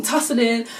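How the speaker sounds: ordinary talk, nothing marked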